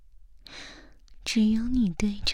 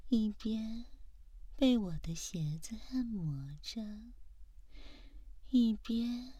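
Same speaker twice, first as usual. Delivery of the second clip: ordinary talk, nothing marked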